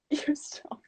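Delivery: hushed, hammed-up self-encouragement; mock self pep talk. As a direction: whisper it like a secret mantra to the mirror. Acting her pep talk to mirror.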